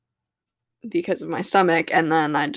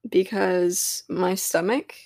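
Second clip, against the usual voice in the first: ordinary delivery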